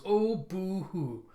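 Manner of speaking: high, drawn-out fake pity; exaggerated teasing boo-hoo; mocking sympathy. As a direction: jeer at a crybaby in a slow high voice. Mocking Driller's self-pity.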